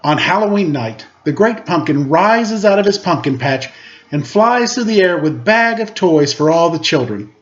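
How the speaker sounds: plain reading voice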